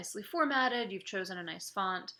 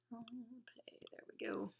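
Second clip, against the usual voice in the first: whispered; 12 dB quieter, slower than usual. Real, not acted. Teacher playing the clip.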